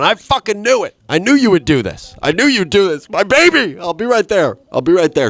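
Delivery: hammed-up panic for the golf cart; frantic outburst. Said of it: "I'm going".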